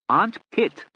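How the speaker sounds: loud, high call; shouted. A narrator's reading. Lord Charles shouting to his deaf aunt, loud. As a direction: shout it in her ear. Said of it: "he shouted".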